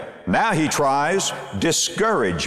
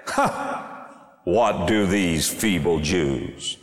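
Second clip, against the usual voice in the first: biting sarcastic scorn; slower than usual. Acted. Sanballat mocks the builders before his army, with withering scorn. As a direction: sneer each question slowly, withering and mocking. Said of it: "Can you hear the sarcasm? Can you hear the biting, withering scorn".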